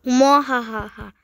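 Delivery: gloating villain laugh; evil cackle. Acted. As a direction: cackle like a mad scientist. Evil laugh after evil plan.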